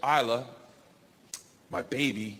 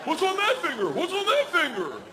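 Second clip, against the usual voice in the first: much higher, excited dog; high frantic dog; eager dog voice; 12 semitones higher than usual. Pretend. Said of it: "the dog comes right back like".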